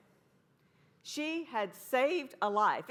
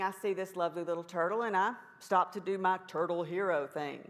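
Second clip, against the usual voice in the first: normal talking voice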